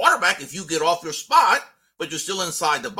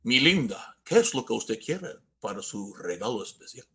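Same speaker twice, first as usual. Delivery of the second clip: low, soft Latin lover voice; Spanish Santa; 6 semitones lower, 3 dB quieter than usual. Pretend. Wooing a sweetheart about her gift, Spanish Santa voice. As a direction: purr it low, like a telenovela suitor. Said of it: "Is it a Spanish Santa?".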